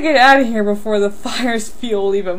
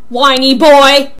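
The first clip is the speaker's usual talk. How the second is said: loud, drawn-out jeer; loud taunt; 8 dB louder, slower than usual. Acted. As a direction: throw the insult back loudly, stretching it. Taunt thrown back in quarrel.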